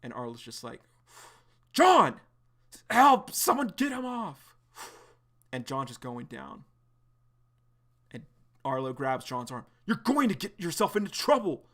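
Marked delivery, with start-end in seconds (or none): 1.7-4.6 very high, very loud scream for help; panicked yell. Acted. Bystander yelling for help. 9.9-11.6 higher, louder stern warning; urgent warning. Acted. Arlo restraining John.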